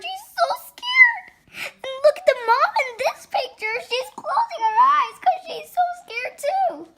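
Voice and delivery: high-pitched, wound-up pointing at the pictures; excited alarm. Real, not acted. Child shows listeners the scared mom and George, excited alarm.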